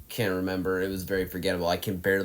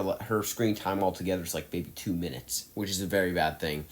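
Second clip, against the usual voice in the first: ordinary talk, nothing marked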